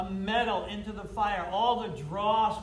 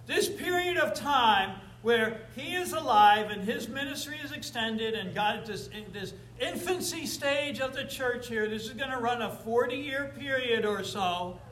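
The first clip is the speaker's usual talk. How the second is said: preaching at full force; 5 semitones higher, 3 dB louder than usual. Acted. Preacher driving his point home.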